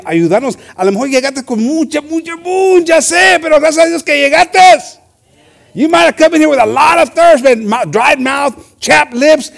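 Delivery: high, loud preacher's exhortation; rally the congregation; full-force preaching. Acted. Preacher urging the congregation to keep fighting, fervent preaching.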